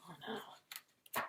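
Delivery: breathy mock dismay; whispered oh no. Reader sighs over Harold's lost house, whispered.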